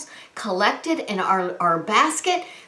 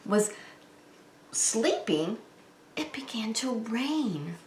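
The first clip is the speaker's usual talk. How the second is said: whisper; 9 dB quieter than usual. A narrator's reading. Storyteller hushes for the rain.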